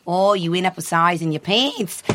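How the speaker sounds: badly faked Kiwi wardrobe designer; mock New Zealand accent for a retold remark; terrible New Zealand accent. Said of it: "That was a terrible New Zealand accent".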